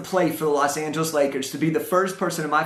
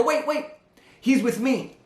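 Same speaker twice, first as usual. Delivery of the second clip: high, hurried blurt, voice jumping up; urgent blurt; 6 semitones higher than usual. Retold or imitated. Young employee blurts to the elevator operator, high and urgent. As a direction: blurt it out before the door shuts. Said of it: "I blurt out and I go".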